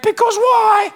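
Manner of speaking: put-on high, slow whiny child asking why; toddler voice. Dad imitating his son's whiny question. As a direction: mimic a small boy's drawn-out question. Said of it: "Archer quote".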